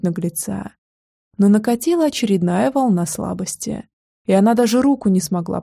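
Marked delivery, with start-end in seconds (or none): none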